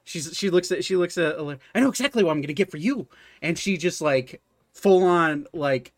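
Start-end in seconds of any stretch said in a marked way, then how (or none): 1.8-3.1 higher and much faster, bursting with the idea; eager shopkeeper. Acted. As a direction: blurt it out as she dashes off. Repeated, now fully voiced.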